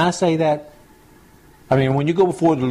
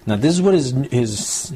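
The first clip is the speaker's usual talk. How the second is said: normal talking voice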